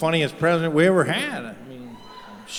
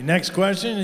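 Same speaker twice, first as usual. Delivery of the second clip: usual speaking voice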